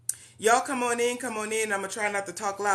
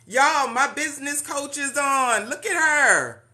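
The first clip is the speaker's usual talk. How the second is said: excited; 6 semitones higher, 6 dB louder than usual. Real, not acted. Announcing her coach joined.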